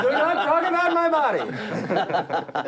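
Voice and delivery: high, slow, hammed-up sassy tone; mock sassy voice. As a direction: play it like an offended diva, giggling. Mock sassy comeback while laughing.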